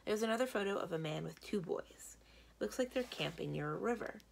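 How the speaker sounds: usual speaking voice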